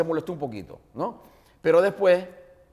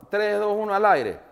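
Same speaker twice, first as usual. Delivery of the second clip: radio countdown; 8 semitones higher, 7 dB louder, slower than usual. Retold or imitated.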